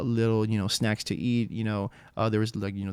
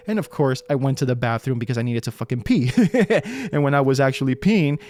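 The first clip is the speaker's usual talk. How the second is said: ordinary delivery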